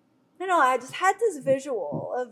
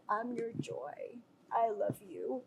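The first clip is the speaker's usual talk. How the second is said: softer and slow, like a loving whisper; tender voice of Jesus; 8 dB quieter, slower than usual. Retold or imitated. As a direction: speak his love gently, unhurried. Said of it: "Jesus says".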